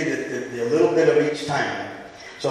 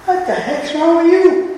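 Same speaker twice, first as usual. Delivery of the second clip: high, loud, furious shout; furious king; 13 semitones higher, 9 dB louder than usual. Acted. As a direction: explode at him in high fury. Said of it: "The king is furious".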